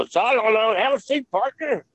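high-pitched imitation of a co-star; Paul Partain's voice. Co-star inviting the newcomer to sit, high imitated voice. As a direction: put on a high voice for the quoted invitation. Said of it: "and he said".

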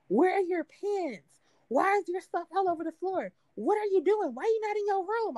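high, rapid-fire scolding questions; mock scolding brother.

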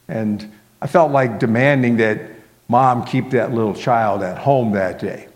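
plain reading voice

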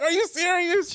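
very high, loud incredulous question; laughing disbelief, retold. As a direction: retell it as amused, squealed disbelief. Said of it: "I, I'm laughing".

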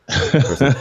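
a hint of an old Southern drawl; mock old-timey Southern voice; antebellum person. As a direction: lightly play a genteel antebellum type. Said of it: "It makes me sound like some, like, antebellum".

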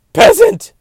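very high, very loud mock shriek; shrieked insult. Comic shrieking a haughty put-down at a commoner. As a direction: screech the word like an offended queen.

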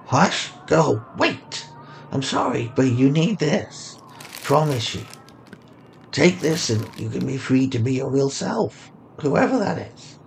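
soft, urgent ragged stranger; old man voice. Acted. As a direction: plead softly like a vagrant. Old man's urgent plea.

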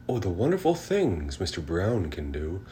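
usual speaking voice

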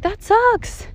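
high, whiny complaint; whiny reluctant gym-goer. Retold or imitated. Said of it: "You're gonna be like".

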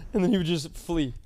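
usual speaking voice

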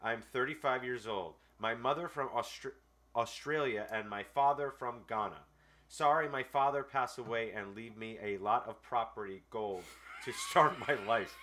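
slow, deliberate read of a clumsy letter; dry dramatic reading; reading a letter aloud. Acted. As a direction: read it word for word, pauses and all. Reads a scam letter aloud.

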